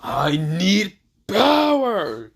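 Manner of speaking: slow, drawn-out shout rising high; Virgil. Retold or imitated. Game character from Devil May Cry, drawn-out power cry. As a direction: stretch each word, then belt the last one. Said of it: "basically when Virgil says".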